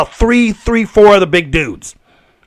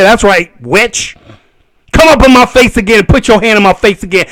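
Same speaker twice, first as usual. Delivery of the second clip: usual speaking voice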